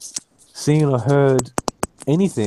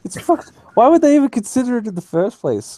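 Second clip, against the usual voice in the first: exasperated; 6 semitones higher, faster than usual. Real, not acted. Ranting about coal tariffs.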